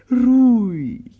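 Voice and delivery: sarcastic outburst; sarcastic loud echo. Acted. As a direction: throw the word back, loud and high. Mocking reply to "stay calm.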